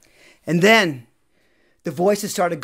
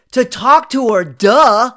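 higher and louder, mocking the obvious; teasing duh; 4 semitones higher, 9 dB louder, slower than usual. Teacher ribbing the student about the girl, loud teasing duh. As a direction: lean on the duh like it's plain to anyone.

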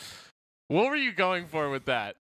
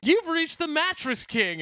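ad announcer; 11 semitones higher, 3 dB louder than usual. Pretend. Mock ad voicemail.

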